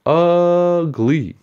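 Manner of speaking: very high, loud sing-song jeer; mocking echo. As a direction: squeal the word out to mock him. Boy echoes the insult.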